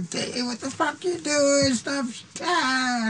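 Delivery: very high, drawn-out mock screams; imitated panicked yelling. Frightened friend yelling at the shooter, mock panic. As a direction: play someone running from gunfire, voice way up.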